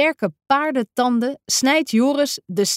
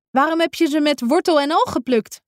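higher, faster, puzzled asking; surprised question; 6 semitones higher, faster than usual. A narrator's reading. Don vraagt John verbaasd naar de wortels, surprised and quick. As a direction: sound baffled by the roots. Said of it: "vraagt Don verbaasd".

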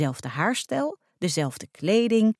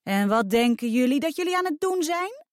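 high, rising challenge; calling out; 8 semitones higher than usual. A narrator's reading. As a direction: call them to account, pitch climbing to the end. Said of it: "riep een stem".

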